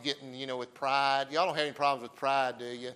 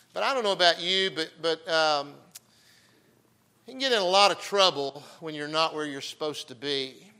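loud, raised-pitch pulpit delivery; preaching; 3 semitones higher, 7 dB louder than usual. Real, not acted. Preacher opening Second Samuel to congregation, loud preaching. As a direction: preach it out forcefully with weighted key words.

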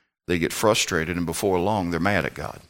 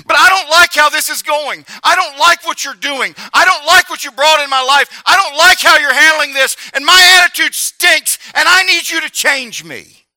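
anguished prayer; 8 dB louder than usual. Retold or imitated. Pastor in his prayer closet confessing to God, loud pleading. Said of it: "I've said".

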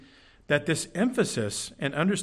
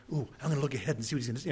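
fast, mock-eager listener aside; distracted listener; 5 dB quieter, much faster than usual. Congregant imitating a distracted listener, in a hushed rush. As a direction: rattle off a sneaky listener's thought. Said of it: "don't get distracted with this".

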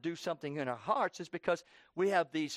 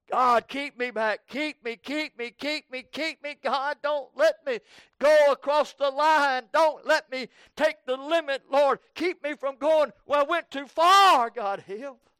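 high, loud preacher crying out to God; impassioned pleading prayer; 10 semitones higher, 10 dB louder than usual. Acted. Preacher begging God to hold them back, impassioned pleading. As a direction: plead at full force, voice pitched way up.

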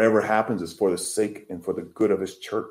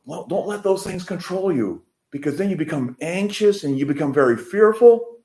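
a touch higher, gently voicing the Lord; voice of God, higher; 9 semitones higher than usual. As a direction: speak as God, lightly lifted. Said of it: "the Lord says".

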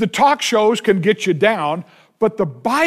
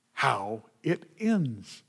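hushed; 6 semitones lower, 11 dB quieter, slower than usual. Acted. Hushed on the outcome.

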